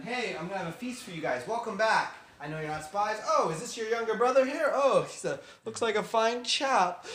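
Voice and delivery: loud, high-pitched excited greeting; hearty welcome. Acted. Joseph welcomes his brothers to a feast, hearty excitement. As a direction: play the effusive host, building in volume.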